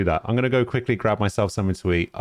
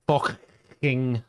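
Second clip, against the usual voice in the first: "fucking" in a higher Scouse accent with a crackly, throaty "ck"; 4 semitones higher than usual. Shown as a demonstration. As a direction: crackle the middle of "fucking" like a Liverpudlian. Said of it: "give it that crackling".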